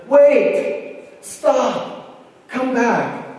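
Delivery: high, loud urgent plea; acted-out frantic shout; desperate calling out. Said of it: "a man who was virtually almost in tears".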